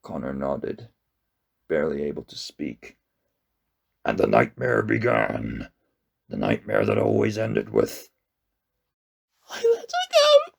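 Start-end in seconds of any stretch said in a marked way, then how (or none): 4.0-8.1 deep, calm ancient monster; a gentle giant speaking low. A narrator's reading. 9.5-10.5 high, strangled sob; choked out. A narrator's reading. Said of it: "Connor choked out".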